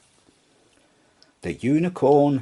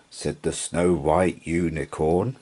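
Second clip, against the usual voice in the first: ordinary delivery, nothing marked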